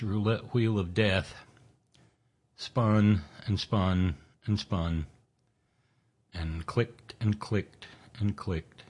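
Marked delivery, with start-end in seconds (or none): 2.6-8.8 slow dramatic build. Acted. Dramatic roulette narration.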